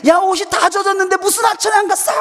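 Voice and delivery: high-pitched shouted accusation; angry mother. Retold or imitated. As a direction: shout like a mother catching a lie. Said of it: "막 화를 내시는 거예요".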